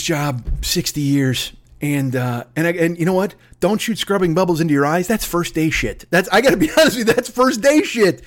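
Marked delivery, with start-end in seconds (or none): none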